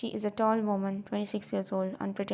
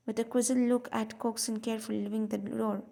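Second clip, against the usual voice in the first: ordinary delivery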